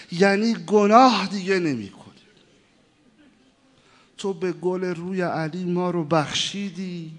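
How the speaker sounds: loud pleading promise to God, fading at the end; fervent vow prayer. Acted. Preacher vowing for the crowd, fervent then hushed. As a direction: swear the vow before God with passion.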